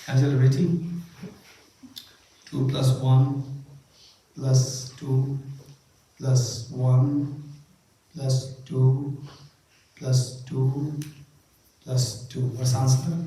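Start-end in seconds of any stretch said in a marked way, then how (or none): 2.4-12.4 unhurried sum with long pauses; measured, spaced-out arithmetic dictation; slow counting. Shown as a demonstration. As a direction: dictate each step separately, leaving a gap after it. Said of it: "Because I said it too fast".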